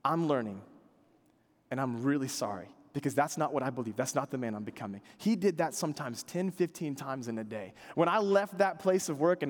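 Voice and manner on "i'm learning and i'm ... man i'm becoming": low, soft, contrite confession; remorseful apology. Retold or imitated. Coworker apologizing to David after cussing, soft and contrite. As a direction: hold his shoulder and mean every word quietly. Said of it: "he would grab my shoulder and say".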